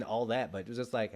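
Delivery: usual speaking voice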